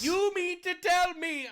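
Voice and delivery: slow, high mock incredulity; sarcastic. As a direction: stretch it out as if utterly astonished. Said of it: "I have to stop with the sarcasm".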